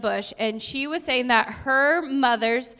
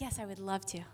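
much softer, through gritted teeth; grudging; 4 semitones lower, 12 dB quieter than usual. Retold or imitated. Tired mother to interrupting kids, softly grudging. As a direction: mutter it like a reluctant chore. Forced reply to kids' interruptions.

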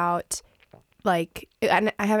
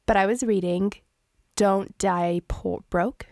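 normal talking voice

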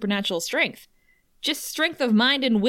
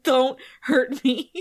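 slow, lightly voiced pleading; a hint of a cowering minion begging; mock timid plea; whimpering minion; 5 semitones higher, slower than usual. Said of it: "he's like".

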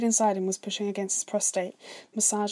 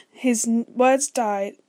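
words dying into groans; 3 semitones higher, 8 dB louder, much slower than usual. Acted. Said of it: "His words died and replaced with groans".

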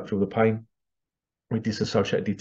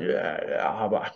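changed voice; 2 semitones lower, 3 dB quieter, slower than usual. Retold or imitated. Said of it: "his voice was a bit different".

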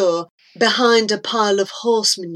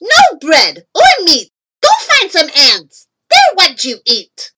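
put-on loud, high, scolding boy; high-pitched angry yell; shouting; 6 semitones higher, 7 dB louder than usual. A narrator's reading. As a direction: shout a bossy refusal. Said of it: "Roy shouted".

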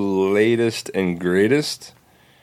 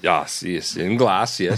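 put-on Russian accent; slower than usual. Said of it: "Russian accent talk".

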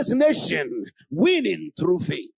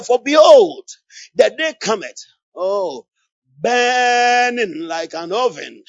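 loud preaching; 9 dB louder, slower than usual. Real, not acted. Preaching at full force.

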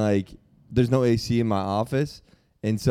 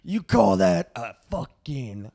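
drill sergeant; 6 semitones higher than usual. Pretend. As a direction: yell at the recruit doing pull-ups. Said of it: "let me try being drill sergeant".

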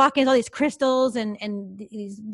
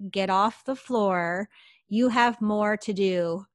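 slow, deliberate command in clear English; much slower than usual. Retold or imitated. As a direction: spell it out slowly, as a disembodied command. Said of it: "in very clear English".

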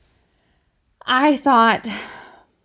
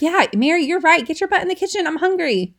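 lightly voiced bossy kid, higher; a child asking mom to side with them, raised pitch; imitating a kid; 7 semitones higher, faster than usual. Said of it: "They think that I'm gonna be like".

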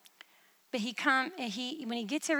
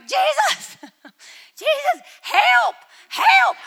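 very high, loud frantic plea; panicked cry for help; 14 semitones higher, 13 dB louder, much slower than usual. Retold or imitated. Frightened in a dark vision, calling Jesus in high panic. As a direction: shriek for Jesus as the snarling closes in. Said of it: "I'm like".

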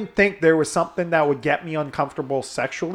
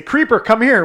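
higher, louder mock summons; host calling someone over; 7 semitones higher, 6 dB louder than usual. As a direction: call him over like a party host. Acting out host summoning Creeper.

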